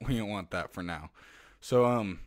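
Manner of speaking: ordinary talk, nothing marked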